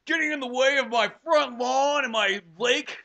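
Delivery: drawn-out mock yuppie complaint. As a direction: sneer it out like an entitled rich neighbor. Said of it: "they're like".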